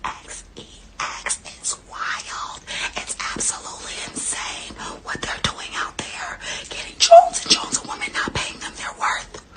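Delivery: breathy whispered rant, half-mocking; soft conspiratorial whisper; dramatic whisper. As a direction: hush it like a secret confession over music. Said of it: "dramatic whispered rant over music".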